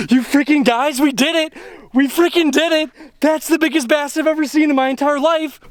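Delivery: high, elated celebration; triumphant. Real, not acted. Angler celebrates his biggest bass ever, triumphant. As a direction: crow to the viewers, pitch up.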